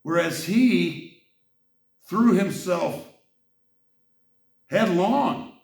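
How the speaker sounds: headlong hit high and loud, slowly; slow, incredulous build to headlong; rising exasperation at the text; angry emphasis. Real, not acted. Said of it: "I get very angry sometimes".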